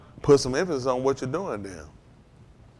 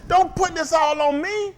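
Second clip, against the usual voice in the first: mock protest shout; 12 semitones higher, slower than usual. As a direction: shout it back like a protesting churchgoer. Quoting congregation protest.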